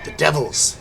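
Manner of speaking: put-on old hag. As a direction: hiss it like a disembodied hag. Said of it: "hisses the hag's disembodied voice".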